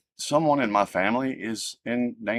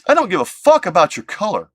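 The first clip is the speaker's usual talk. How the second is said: angry outburst; 9 semitones higher, 7 dB louder than usual. Retold or imitated. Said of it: "And I'm like".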